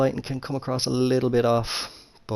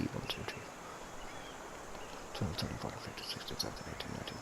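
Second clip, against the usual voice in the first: near-whispered count, much softer; counting under breath; 4 semitones lower, 17 dB quieter, faster than usual. Real, not acted. Gamer counting his shot power to himself, whispered. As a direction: breathe the numbers out barely voiced.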